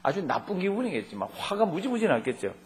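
usual speaking voice

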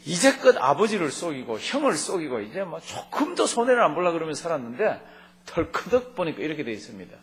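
ordinary talk

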